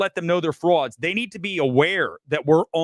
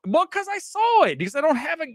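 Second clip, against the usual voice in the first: high mock reply; 12 semitones higher than usual. Answering fans, much higher voice.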